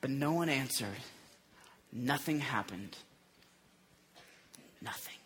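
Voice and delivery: low, very soft, with long pauses; quiet, deflated storytelling; hushed letdown. A narrator's reading. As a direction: let it drop away into near silence. Lets the failed prayers fall silent.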